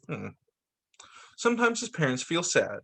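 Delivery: ordinary delivery, nothing marked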